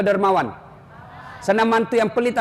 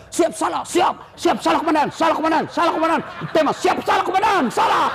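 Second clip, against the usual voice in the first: policeman shouting at attention; 7 semitones higher than usual. Retold or imitated. Policeman scolded by his commander, shouting at attention. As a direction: snap out each reply like a scolded recruit.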